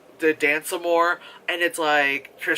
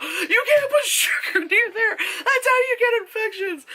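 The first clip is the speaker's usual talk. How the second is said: screamed warning. Retold or imitated. Vlogger yelling at the screen about sugar, shrieking. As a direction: scream it, cracking up. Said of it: "I probably would've screamed out".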